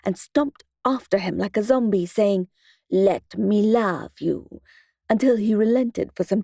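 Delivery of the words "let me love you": slow, stomping, hammed-up Italian-Russian accent; bad Italian-Russian accent. Mother teasing her son in a bad Italian-Russian accent. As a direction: drag it out in a mock Italian-Russian accent like a zombie. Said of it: "I put on my worst Italian-Russian accent".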